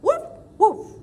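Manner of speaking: loud, high woofs; barking dog. Acted. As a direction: bark out like a dog. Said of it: "she makes a sound like this".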